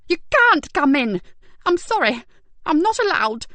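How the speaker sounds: high, loud, slowed pleading; scared she'll be blamed; fearful. Acted. Frightened carer begging police not to enter. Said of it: "expression turned from anger to fear".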